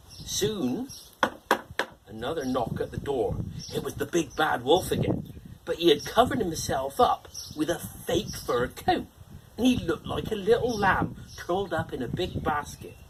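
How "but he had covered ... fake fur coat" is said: ordinary delivery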